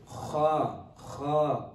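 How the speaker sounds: kha as a full-mouth letter, drawn out; correct kha. Shown as a demonstration. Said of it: "this is the way we should pronounce it".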